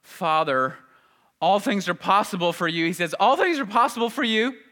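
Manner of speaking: loud, high, anguished pleading to the Father; groaning prayer. Acted. Jesus groaning his prayer to the Father in anguish. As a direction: cry it out like a man crushed in soul. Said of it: "groaning out these words instead of just speaking them".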